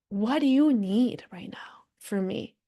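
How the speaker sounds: slow, whispered tender question; gentle whisper. Acted. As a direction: whisper it as an offer of care. Gently asking an inner part.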